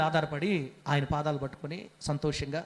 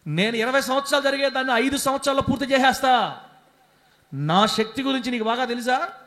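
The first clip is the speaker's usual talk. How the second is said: booming, higher voice of God rebuking worry; God's voice; 6 semitones higher, 5 dB louder than usual. Acted. Pastor as God tells the congregation not to grieve, booming loud. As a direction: thunder out God's promise to a fretting people.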